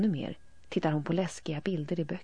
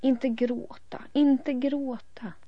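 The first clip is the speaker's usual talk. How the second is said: much higher, slow, pleading; despairing plea; 9 semitones higher, 6 dB louder, much slower than usual. A narrator's reading. The half brother desperately begging Elin not to cry. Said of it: "säger halvbrodern förtvivlat".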